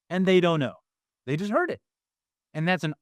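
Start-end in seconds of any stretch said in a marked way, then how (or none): none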